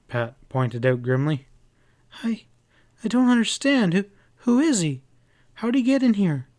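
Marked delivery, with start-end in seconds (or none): 2.2-6.5 higher, stammering frightened questions. Acted. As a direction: stammer like you're terrified. Frightened woman questioned by sheriff.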